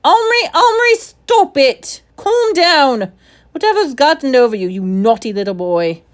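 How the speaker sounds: high, loud flustered scolding; elderly secretary struggling to calm a boy; secretary holding a boy, scolding loudly; scolding shout. Acted.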